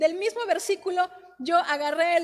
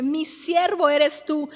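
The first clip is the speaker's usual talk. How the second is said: slow, louder proclaimed verse; preaching scripture; 6 dB louder than usual. Acted. Wife preaching a verse to her husband, proclaiming it slowly. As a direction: declare God's promise with weight. Reading scripture with full force.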